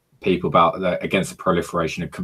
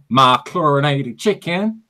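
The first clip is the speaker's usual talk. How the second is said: put-on high, slow American accent; mock American voice, drawn out; 8 semitones higher, 3 dB louder, slower than usual. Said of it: "I had to do that in American accent".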